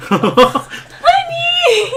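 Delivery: high, loud mock-tearful cry; tearful call. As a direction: wail for help, tearing up. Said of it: "He's tearing up".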